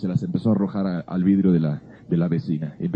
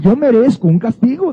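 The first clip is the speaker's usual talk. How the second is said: higher, louder plea of guilt; remorseful friend; 10 semitones higher, 6 dB louder than usual. Retold or imitated. Guilty boy tells his friend he deserves punishment, higher and louder. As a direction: insist on your own punishment like a guilty boy. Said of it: "decía mi amigo".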